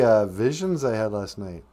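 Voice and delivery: plain reading voice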